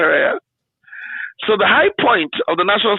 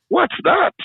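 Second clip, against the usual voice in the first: high, puzzled retold question; mock baffled; 12 semitones higher than usual. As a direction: play your younger self, lost and surprised. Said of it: "I'm like".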